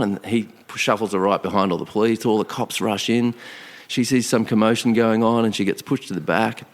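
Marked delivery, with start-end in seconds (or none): none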